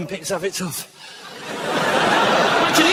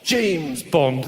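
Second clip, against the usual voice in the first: lightly lower, suave Bond delivery; mock Bond line; 8 semitones lower, much slower than usual. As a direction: play the catchphrase lightly smug. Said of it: "I hate it when he says that".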